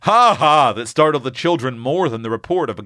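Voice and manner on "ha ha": higher, louder narrated guffaw; hearty acted laugh in words. A narrator's reading. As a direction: burst out with a spoken ha ha. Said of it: "changed to a loud".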